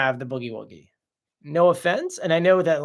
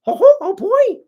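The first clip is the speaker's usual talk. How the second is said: very high, loud cartoon squeal; Disney voice; 10 dB louder than usual. Host trying the Disney voice he imagines, high and loud. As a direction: attempt a high-pitched Disney character. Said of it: "in the Disney vo-, voice".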